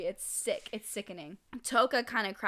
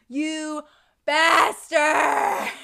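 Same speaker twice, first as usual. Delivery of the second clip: high, drawn-out acted scream; dramatic enraged battle cry; furious shout; 6 semitones higher, 12 dB louder, much slower than usual. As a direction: build from a raised "you" into a full yell. Voicing Toka enraged at Mato.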